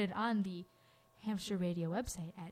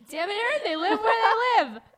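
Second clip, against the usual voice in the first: much louder and higher, hammed-up frustration; mock exasperated shout; 12 semitones higher, 11 dB louder than usual. Host shouts at cohost over where family lives, hammed-up shout. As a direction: blow up in fake outrage.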